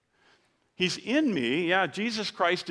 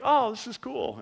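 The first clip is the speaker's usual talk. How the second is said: wife's delight; 8 semitones higher than usual. Retold or imitated. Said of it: "she's like".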